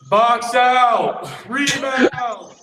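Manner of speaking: high, loud gym shout; mock coach yelling across the court; screaming coach. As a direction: holler it like a coach at players. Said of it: "Oh, just screaming".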